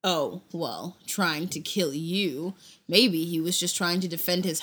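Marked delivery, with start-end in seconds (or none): 0.0-2.6 sarcastic. Acted. Hagrid mocking Bilbo's claim.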